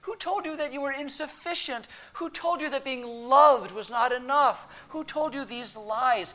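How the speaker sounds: high, pained pleading; acted anguish, voice soaring on the questions. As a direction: plead like a heartbroken loving parent. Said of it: "Can you hear the pain in God's voice?".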